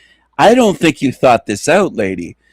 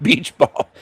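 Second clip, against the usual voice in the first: usual speaking voice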